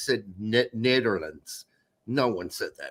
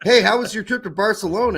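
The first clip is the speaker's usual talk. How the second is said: cheery friend; 5 semitones higher, 5 dB louder, faster than usual. Retold or imitated. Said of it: "he's like".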